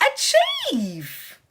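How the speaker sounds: achieve leaps up high and drawn out; sudden high note on achieve; voice jumps up. Real, not acted. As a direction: let achieve soar up high. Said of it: "Don't know why I went up in my voice".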